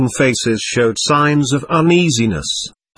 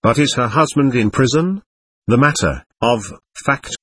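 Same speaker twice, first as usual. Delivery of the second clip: plain reading voice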